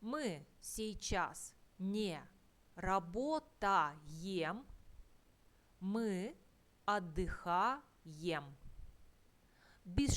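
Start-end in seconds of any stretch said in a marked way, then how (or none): 0.0-8.4 "работаем" e "отдыхаем" said slowly, syllable by syllable, like a teacher; slow drill. Shown as a demonstration. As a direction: draw out each word of the correct sentence slowly for the students. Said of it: "slow drill of correct form".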